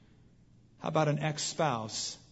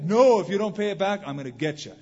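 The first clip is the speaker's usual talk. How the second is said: harsh refusal; 4 semitones higher, 9 dB louder than usual. Retold or imitated. Creditor refuses the pleading debtor.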